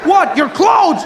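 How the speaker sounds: high shouted disbelief; shocked traveller. Retold or imitated. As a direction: yell in shock.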